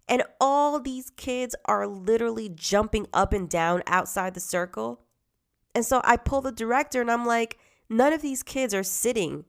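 high, loud, worked-up telling; heated. Real, not acted. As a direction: get fired up calling them out. Heated retelling confronting director.

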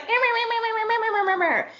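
high-pitched mocking "meh meh" whine; nagging ex-wife. Imagined ex-wife nagging the single architect, high mocking babble. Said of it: "this ex-wife who's gonna be like".

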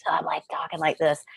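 breathless whisper of a hurt throat; injured patient demonstrates a whispered, strained voice; strained talking. Shown as a demonstration. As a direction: talk like you can't swallow, barely voiced. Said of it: "I'm talking funny".

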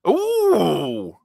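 high, falling "ooh"; long ooh that slides down from high; drawn-out ooh. Real, not acted. Co-host reacting to a score of two and a half, high sliding ooh.

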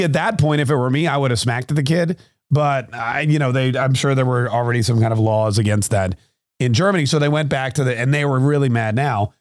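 ordinary delivery

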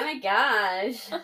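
put-on slow drawn-out Valley Girl gasp; Valley Girl accent. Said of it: "I've been doing a Valley Girl accent today".

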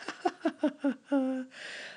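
high, breathy giggle; high laugh. Real, not acted. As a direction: laugh at your own voice. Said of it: "laugh at my voice".

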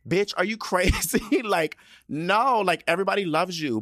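high, loud, disbelieving outburst; exasperated high-pitched reassurance; incredulous. Real, not acted. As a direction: protest loudly that it's absurd, voice shooting up. Rebutting the star's self-doubt.

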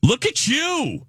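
high, slow delighted gush; excited praise. Real, not acted. Praising friend's humble brag.

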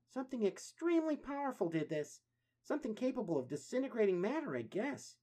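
high, wondering tones; amazed turtle voices. Acted. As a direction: marvel at the strange hole. Turtles at the hole.

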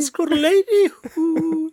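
very high, slow cartoon voice; silly high voice. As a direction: squeal it like a cartoon. Repeating bit in silly voice.

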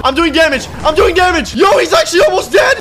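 high, loud mid-fight hype; excited shouting. Real, not acted. Gamer sees the boss almost dead, excited shouting.

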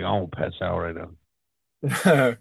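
put-on slow, slurred drunk voice. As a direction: slur it like you're wasted. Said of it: "I think I was too drunk".

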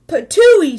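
loud spat-out patooey; spitting out the awful stew. A narrator's reading. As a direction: spit it out in disgust. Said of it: "said Papa".

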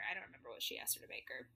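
normal talking voice

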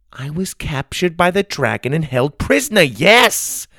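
high voice building to YES; emphatic confirmation. A narrator's reading. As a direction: spell it out, exasperated. Grandma confirms after stunned silence.

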